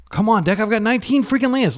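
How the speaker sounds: high-pitched exasperated outburst; hysterical. Real, not acted. Player begging the deck for lands, hysterical. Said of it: "hysterical sounding voice".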